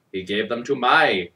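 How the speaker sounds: plain reading voice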